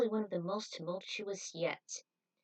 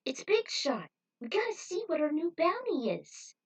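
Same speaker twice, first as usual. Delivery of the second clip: higher, eager pleading push; excited urging; 9 semitones higher, 4 dB louder than usual. Acted. Trudy urges her mentor not to miss the show, high and eager. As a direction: insist with bouncing excitement.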